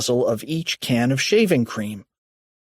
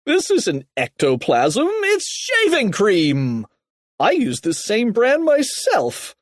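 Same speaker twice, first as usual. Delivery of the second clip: higher, emphatic correcting holler; yell; 9 semitones higher than usual. A narrator's reading. Mister Krupp debunking ectoplasm to Kipper, raised emphatic voice. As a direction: insist in a raised, indignant voice. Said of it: "yelled Mister Krupp".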